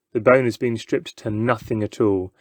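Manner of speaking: ordinary delivery, nothing marked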